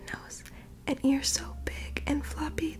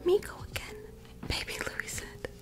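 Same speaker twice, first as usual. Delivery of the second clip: whispered storytelling; 4 dB louder than usual. Acted. Reading Marley's story to sleepy listeners, in a whisper. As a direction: breathe the story out like a bedtime read.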